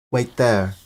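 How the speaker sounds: loud, high call. A narrator's reading. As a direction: call it across the rock. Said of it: "he called to Ron".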